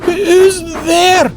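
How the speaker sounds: startled frightened shout; frightened stammer. Acted. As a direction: call out into the fog, terrified. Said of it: "stammered Douglas".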